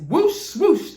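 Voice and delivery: high, swooshing sound effects; whooshing sounds. A narrator's reading. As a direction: swoop the words like rockets.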